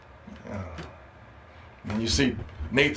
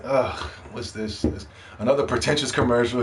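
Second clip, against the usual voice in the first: unimpressed groan; 5 semitones lower, 3 dB quieter than usual. Retold or imitated. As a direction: imitate a viewer losing all interest. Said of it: "just kills the mood".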